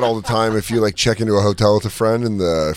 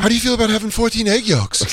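much higher-pitched pitching of a regimen; mock fitness fanatic; 10 semitones higher than usual. Friend plays actor proposing extreme breakfast, mock eager. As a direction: pitch it high as an overkeen health nut. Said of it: "he goes".

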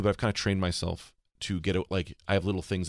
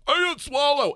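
mock panic; 6 dB louder than usual. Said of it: "I'm like".

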